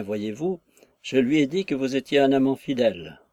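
usual speaking voice

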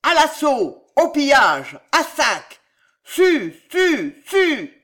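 high, very loud attack cries; fierce shouts in short bursts; savage yelling. A narrator's reading. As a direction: yell like a raider launching a sack.

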